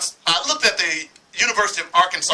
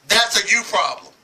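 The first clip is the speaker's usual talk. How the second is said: ordinary talk, nothing marked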